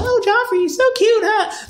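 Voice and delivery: very high, fawning falsetto; mock swooning girl. Recapper mocking the girl fawning over the prince, falsetto swoon.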